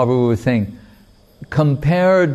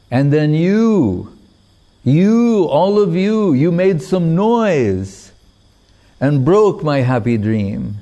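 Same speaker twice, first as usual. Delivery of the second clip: rising lament; 5 semitones higher, slower than usual. Retold or imitated. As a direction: lament climbing much higher, slow and accusing. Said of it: "then he's crying and lamenting".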